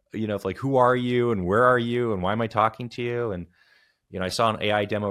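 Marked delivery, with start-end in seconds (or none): 0.6-3.3 mock angry caller, a bit louder; imitating caller rage. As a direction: play a fed-up caller losing patience. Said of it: "caller rage that comes out".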